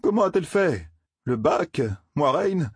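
voix qui grimpe, incrédule; demande-le avec stupeur, haut perché; questions stupéfaites. Acted. Matt stupéfait par la nouvelle. (stupéfaction, ton aigu)

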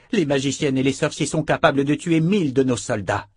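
heated soldier. Acted. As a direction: argue hard for your fallen comrades. Character dialogue voiced in reading.

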